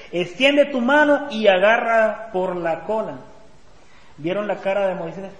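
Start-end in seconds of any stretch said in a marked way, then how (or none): 0.1-3.2 louder, slow instruction read aloud; emphatic command. Acted. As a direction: lightly act God giving a firm order. Reading God's command to Moses.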